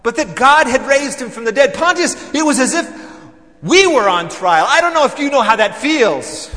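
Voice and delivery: loud, heated, pressing every phrase; agitated loud telling. Acted. Pharisee to Pontius, loud and heated. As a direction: push it out like a man still stung. Indignant recounting of the trial.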